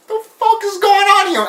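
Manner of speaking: hammed-up panic, pitch jumping up; freaked-out disbelief. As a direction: squeak out a mock freak-out in disbelief. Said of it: "Alex is just like".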